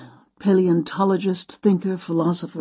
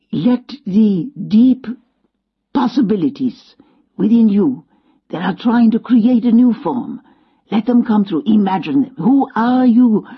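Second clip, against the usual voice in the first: put-on old Frenchman urging her on, French accent, rising to a higher, louder call; 3 semitones higher, 4 dB louder than usual. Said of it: "He had a French accent".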